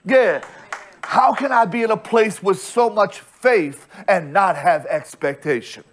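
ordinary talk, nothing marked

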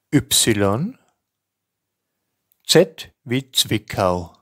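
each letter and name spoken slowly and distinctly with pauses; careful spelling dictation. Shown as a demonstration. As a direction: dictate it clearly, like spelling over the phone. Teacher reads spelling alphabet.

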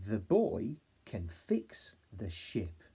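very slow, even sentence; slow read. Shown as a demonstration. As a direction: say each word slowly. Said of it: "Try and hold this sentence in your head".

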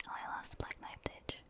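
ordinary talk, nothing marked

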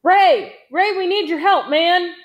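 loud, high-pitched urgent call. A narrator's reading. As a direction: call to a buddy across a battlefield. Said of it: "Big John called".

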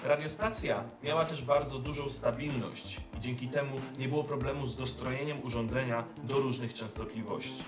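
ordinary talk, nothing marked

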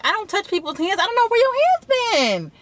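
high, sharp indignant brush-off; retelling the rebuff to the guy who reached out to shake her hand; indignant retort. Retold or imitated. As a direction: recoil from the stranger's hand. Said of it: "and I'm like".

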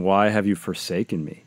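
ordinary talk, nothing marked

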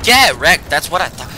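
loud cocky trash talk; taunting. Real, not acted. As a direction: gloat over the kill. Taunting after a kill.

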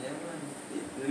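ordinary talk, nothing marked

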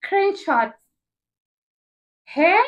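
high, loud disbelieving repeat of Screenshots; shocked. Real, not acted.

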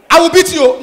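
high, loud threat; mock threat. Mother threatening her son, high and sharp.

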